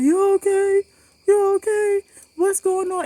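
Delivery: loud, high mock of fussing people; crowding someone with shrill-pitched mock concern; mimicking pestering questioners.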